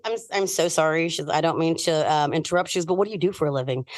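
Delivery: a hint of a timid lady interrupting; polite stranger. Lady behind her speaks.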